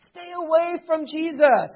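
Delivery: high, loud warning off; sharp rebuke. Acted. Disciples turning children away from Jesus, rebuking. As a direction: order them back forcefully. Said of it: "They rebuked the children".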